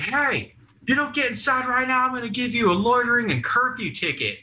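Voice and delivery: high mocking cop voice. Cop yelling at kids on porch.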